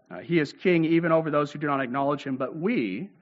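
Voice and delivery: normal talking voice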